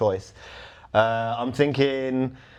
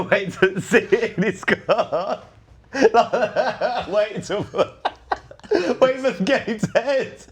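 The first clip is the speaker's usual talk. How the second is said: laughing; 7 semitones higher, slower than usual. Real, not acted. Laughing through the plan.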